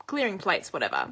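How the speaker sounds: ordinary delivery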